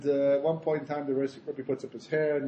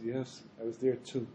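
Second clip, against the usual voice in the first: soft, lower, mildly regretful; sadly; 5 semitones lower, 9 dB quieter than usual. Acted. Rebbe admitting he was at the sin, sad. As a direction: admit it quietly with a touch of sorrow. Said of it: "sadly, he says".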